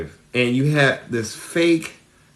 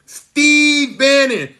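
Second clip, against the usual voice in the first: drawn-out reveal; 12 semitones higher, 5 dB louder, much slower than usual. Retold or imitated. Retold informant answers.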